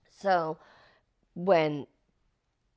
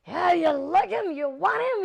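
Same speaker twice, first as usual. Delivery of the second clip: crazed rage; 14 semitones higher, 9 dB louder than usual. Retold or imitated. Movie boyfriend finding out about rival, crazed shouting. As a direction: yell it like he's lost it. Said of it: "he just goes crazy".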